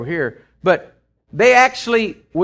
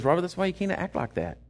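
gentle inner voice; 8 dB quieter than usual. Retold or imitated. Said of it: "it wasn't a booming voice".

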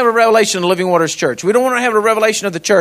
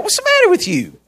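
high-pitched exasperated retort; disgusted; 12 semitones higher than usual. Retold or imitated. Rancher back from shutting the gate to his helper, disgusted. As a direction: scold him in retold disbelief, voice shooting up. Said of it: "I'm so disgusted with this guy".

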